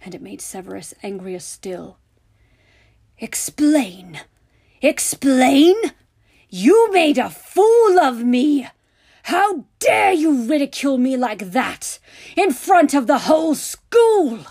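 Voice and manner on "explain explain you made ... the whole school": high, very loud, escalating tirade; incredulous, building to a full yell; furious shouting. A narrator's reading. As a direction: explode at him in outrage. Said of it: "he shouted".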